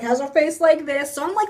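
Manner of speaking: ordinary delivery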